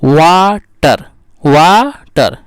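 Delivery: वाटर in two slow beats, वा-टर; split into syllables. Shown as a demonstration. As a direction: stretch वा, pause, then टर in वाटर. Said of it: "टू साइलेबल्स word हो गया". (वाटर को खींचकर वा-टर बोलना)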